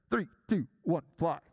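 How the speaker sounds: loud, low countdown call; punchy announcer-style launch count; yelled countdown. Retold or imitated. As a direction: bellow it like a ride operator. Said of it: "this guy's up there yelling this".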